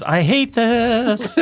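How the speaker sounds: high, slow, strained battle shout; reluctant war cry. Pretend. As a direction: yell it like a hero who hates every second. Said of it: "my war cry is".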